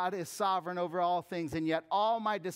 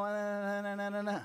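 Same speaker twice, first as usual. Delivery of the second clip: droning na-na-na on one raised note; dismissive filler; 5 semitones higher than usual. Acted. Preacher skimming over his own list of self-made fixes, mock droning. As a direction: drag out the nonsense syllables in a monotone.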